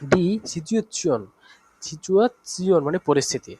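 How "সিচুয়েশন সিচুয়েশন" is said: "সিচুয়েশন" slow and higher, ending in si-on; demonstrated pronunciation. Shown as a demonstration. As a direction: stretch out "সিচুয়েশন" syllable by syllable. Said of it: "এইভাবে বাকি শব্দগুলো".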